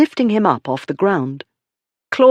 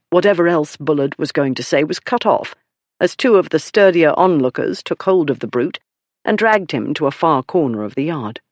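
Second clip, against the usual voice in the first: plain reading voice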